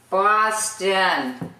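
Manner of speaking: BOSTON drawn out as "Baah-stin"; local Boston pronunciation. Shown as a demonstration. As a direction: teach BOSTON slowly with a broad ah. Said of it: "we spell it like that".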